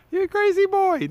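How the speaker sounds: playful teasing. Real, not acted. Laughing at crashing kid.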